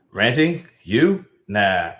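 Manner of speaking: mock-incredulous, pitch jumping up on the questions; sarcastic. Streamer reads out a chatter's teasing message, sarcastic. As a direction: feign surprise with teasing sarcasm. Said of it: "I love that sarcasm".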